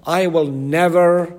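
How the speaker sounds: loud, proud declaration; loud boastful promise of loyalty; boastful vow. Retold or imitated. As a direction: swear it loudly like a braggart. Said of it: "was so arrogant and so boastful".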